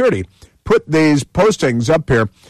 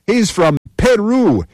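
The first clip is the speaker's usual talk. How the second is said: higher and slower, leaning on Peru; emphatic repeat; 6 semitones higher, slower than usual. Real, not acted. Speaker reading a list of arrests to a crowd, higher, slow emphasis on Peru. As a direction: hold up Peru as the punchline, drawn out.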